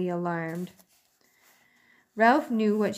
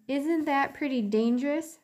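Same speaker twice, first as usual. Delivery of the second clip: put-on higher, fretting old aunt; worried old aunt; 8 semitones higher than usual.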